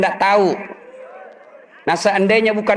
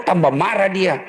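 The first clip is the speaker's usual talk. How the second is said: normal talking voice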